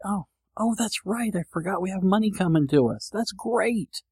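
high, soft "oh, that's right" wonder; acted delighted remembering, light and high; pleased sudden realization. Host replaying remembering forgotten money, pleased realization.

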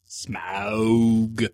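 Smaug said as smowg, rhyming with cow, with distaste; disgusted Smaug. Shown as a demonstration. Correcting the film's Smaug, sounding out smowg. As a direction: drawl Smaug like the film does, sneering. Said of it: "I hate that".

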